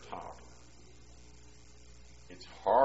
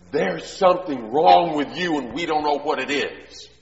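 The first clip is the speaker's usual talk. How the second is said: scolding parent; 6 semitones higher, 10 dB louder than usual. Retold or imitated. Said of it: "Looking at a little six-year-old guy saying".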